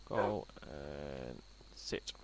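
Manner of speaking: very slow, low "Go and sit"; slow command. Real, not acted. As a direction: drag it out like a dog command. Commanding the wolf.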